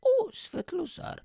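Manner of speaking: very high, fluting voice of the Queen; mock-royal high greeting; Queen impression. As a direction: imitate the Queen greeting a guest in a high-pitched coo. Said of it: "I need to imitate the Queen now".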